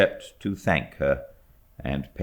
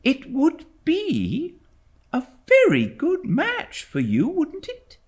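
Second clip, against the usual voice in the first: much higher, light teasing mother's voice; young mother. A narrator's reading. Mother teasing her servant, a high mock-scolding voice.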